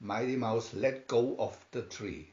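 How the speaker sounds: plain reading voice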